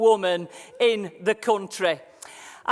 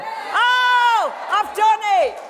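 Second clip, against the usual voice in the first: high, loud cry of delight at fixing the slides; triumphant shout; 11 semitones higher, 8 dB louder, much slower than usual. Real, not acted. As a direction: yell it like you just beat the machine. Finally gets the slides working.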